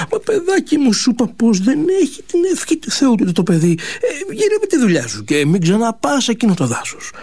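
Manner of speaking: a lightly voiced worried old mother; mild old mother's warning. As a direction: warn him gently like a fretting mother.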